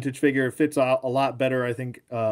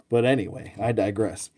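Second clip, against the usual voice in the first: usual speaking voice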